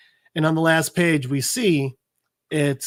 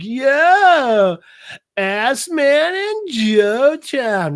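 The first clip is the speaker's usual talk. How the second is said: put-on high, drawn-out drunken holler; drunk guy; 9 semitones higher, 6 dB louder, much slower than usual. Drunk buddy greeting the couple at a beach party, slurred shout. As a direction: play a sloppy drunk hailing his friends. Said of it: "dude's still fucking drunk".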